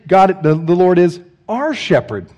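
slow, with "our" drawn out and stressed. Shown as a demonstration. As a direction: put the weight on "our", as if for a whole crowd. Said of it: "He doesn't say".

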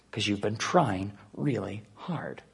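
hushed, slowed whisper to the listeners; soft whispered aside, slowed down. Real, not acted. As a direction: drop to a confiding whisper. Quiet aside to congregation.

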